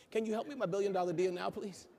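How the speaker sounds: ordinary talk, nothing marked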